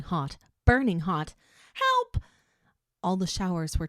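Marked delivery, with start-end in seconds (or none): 1.7-2.2 high, loud desperate cry; scream for help. A narrator's reading. Scalded, calling out.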